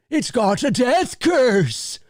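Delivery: high, slow, shaky ominous warning; Crazy Ralph. Retold or imitated. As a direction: croak out the curse like a trembling old prophet. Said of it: "He says".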